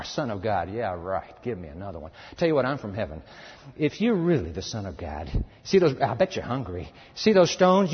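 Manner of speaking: low, mocking devil voice; taunting Satan. Satan taunting the starving Jesus, low and mocking. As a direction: sneer and goad like the devil.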